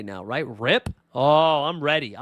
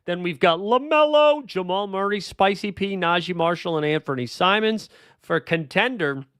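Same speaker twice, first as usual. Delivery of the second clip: ordinary talk, nothing marked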